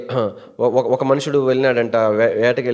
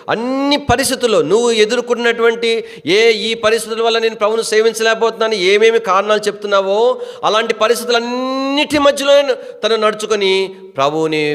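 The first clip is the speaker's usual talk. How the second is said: high-pitched, driving sermon delivery; impassioned preaching; 10 semitones higher than usual. Real, not acted. Preacher answering the congregation's excuses, impassioned. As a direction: pile up the phrases in a high, urgent stream.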